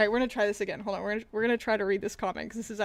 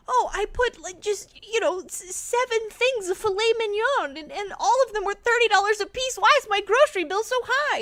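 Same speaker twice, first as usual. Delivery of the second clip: high, whiny mock shopper; put-on whiny complainer; 8 semitones higher than usual. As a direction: mimic a clueless shopper.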